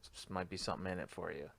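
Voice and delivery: hushed and sly; quiet request to the carny for help; conspiratorial. Retold or imitated. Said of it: "give him the old".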